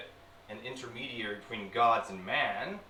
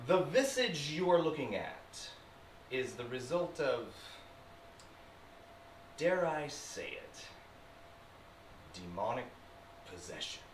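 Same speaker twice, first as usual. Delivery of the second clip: ordinary talk, nothing marked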